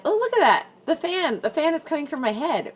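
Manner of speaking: sudden bright, high exclamation; suddenly notice something behind you; a real little gasp of delight; surprised. Real, not acted. Spots a fan behind her head.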